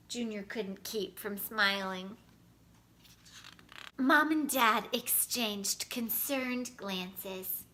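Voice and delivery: ordinary delivery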